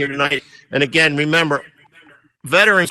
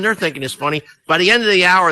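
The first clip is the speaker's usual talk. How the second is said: usual speaking voice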